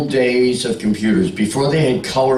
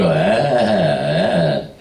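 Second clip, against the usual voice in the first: falling shocked ahs; mock gasps; 5 semitones lower, much slower than usual. Women reacting to his young daughter, falling shocked ahs. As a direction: gasp like a shocked audience. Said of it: "and they all go".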